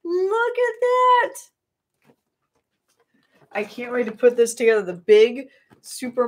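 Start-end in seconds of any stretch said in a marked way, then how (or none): 0.0-1.3 much higher, slow and drawn out; drawn-out high gloating voice; high silly voice. Pretend. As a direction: coo it up high and slow like showing off a treasure. Showing off a present.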